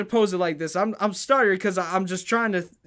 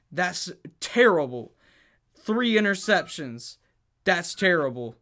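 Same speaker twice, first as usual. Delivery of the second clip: ordinary delivery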